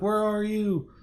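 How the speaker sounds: high mock-frantic call; retold panic. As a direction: imitate a girlfriend calling for her boyfriend. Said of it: "you know, freaking out".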